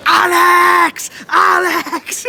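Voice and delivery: high, loud, desperate scream of a name; imitated screaming kid. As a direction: scream the player's name like a frantic young fan. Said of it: "He screamed, going".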